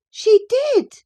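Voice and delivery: high loud cry of protest. Acted. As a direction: shout it, outraged girl. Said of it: "cried my young lady".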